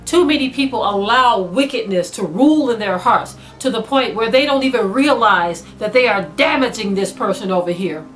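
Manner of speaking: ordinary delivery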